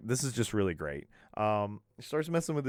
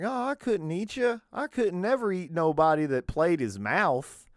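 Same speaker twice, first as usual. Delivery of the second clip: much higher, thick over-exaggerated Southern accent; hillbilly Southern accent; 8 semitones higher, 6 dB louder than usual. Reviewer imitates the possum kid in an over-exaggerated Southern accent. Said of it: "I love over-exaggerated Southern accents".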